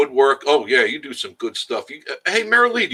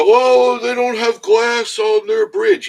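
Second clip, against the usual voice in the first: mock complainer; 12 semitones higher, 7 dB louder, slower than usual. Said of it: "if people go".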